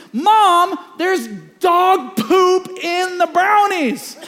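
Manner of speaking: put-on loud slowed-down teen protest; outraged protest. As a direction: spell it out to mom in disgust. Son protests to mom.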